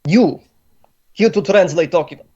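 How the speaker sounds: put-on incredulous Slavic accent. Boss doubts captive can translate, incredulous Slavic accent. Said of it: "pretty good Slavic ac-, accent".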